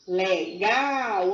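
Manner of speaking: LEGAL split, with GAL drawn out long; stress on GAL. Shown as a demonstration. Teacher showing the stronger sound, LEGAL with a long stressed GAL. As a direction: stretch and lean on the last syllable of LEGAL. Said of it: "olha o som mais forte".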